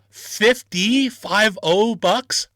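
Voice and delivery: "fifty" stretched out high and loud; incredulous question. Retold or imitated. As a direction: spell out "five oh" slowly in loud disbelief. Balking at the possible price.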